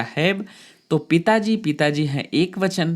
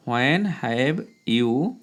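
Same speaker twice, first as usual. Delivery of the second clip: slow dictation; 3 semitones lower, much slower than usual. Shown as a demonstration. Teacher dictating a sentence.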